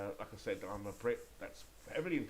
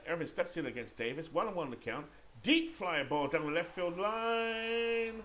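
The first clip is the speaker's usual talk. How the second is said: building to a high, loud, drawn-out call; excited play-by-play; 7 semitones higher, 6 dB louder, slower than usual. Pretend. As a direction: set up the pitch, then soar with the fly ball.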